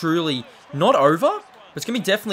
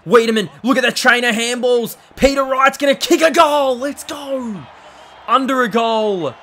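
loud, higher shouted commentary; excited goal call; 6 semitones higher, 5 dB louder than usual. Real, not acted. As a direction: cheer the goal home.

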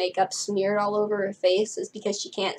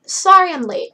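loud, higher "Sorry I'm late" called to the room; announced; 8 semitones higher, 9 dB louder than usual. Acted. The teacher arrives late to the group, announcing it loudly. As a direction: sweep in and announce it to everyone. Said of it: "Miz J announced".

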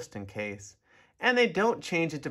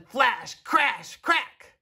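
building excitement; 8 semitones higher, 4 dB louder, much slower than usual. A narrator's reading. Storm sound effects narrated.

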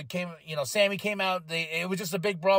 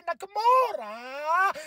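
ring announcer call; 8 semitones higher, 3 dB louder, much slower than usual. Pretend. Ring-announcer style name call.

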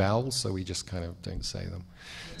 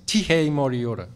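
Tihei Māori ora proclaimed louder; ceremonial call; 5 semitones higher, 8 dB louder than usual. Shown as a demonstration. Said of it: "you start by saying".

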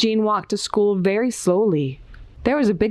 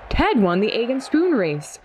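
plain reading voice

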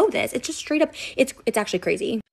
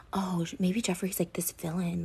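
softer, hushed imitating of a friend; imitated whisper; 8 dB quieter than usual. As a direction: mimic a scared secret whisper. Retelling Shane's secret worry.

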